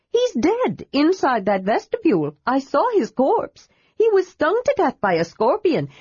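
high, earnest scolding voice; scolding kender. A narrator's reading. Tass scolding the old dwarf who claims to be Karas. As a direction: wag a finger while you reason.

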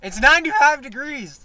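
mock the locals' heat complaint; mock outrage. Friend exaggerating Southern heat talk, loud mock outrage.

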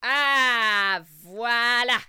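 petite exclamation de sorcière; s'exclame satisfaite; sorcière qui s'exclame. Acted. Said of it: "s'exclama Rabounia". (sorcière contente)

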